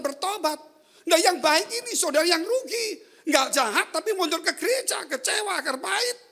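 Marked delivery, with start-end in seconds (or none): none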